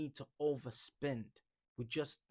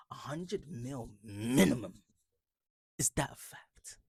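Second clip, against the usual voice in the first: sarcastic whisper; 5 dB louder, slower than usual. Pretend. Sarcastic reply to commenter.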